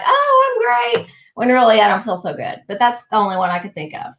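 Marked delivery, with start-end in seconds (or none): 0.0-1.1 high, bright fake-fine voice; fake cheerful. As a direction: put on a cheerful act. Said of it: "I might be like".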